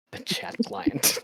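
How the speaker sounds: slow, hushed mock-dramatic whisper. Shown as a demonstration. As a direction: whisper it slowly for effect. Rereads phrase for comic effect.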